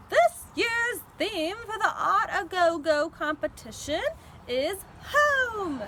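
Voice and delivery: loud announcing voice; announcer. A narrator's reading. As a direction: announce the theme to the crowd. Librarian announcing theme.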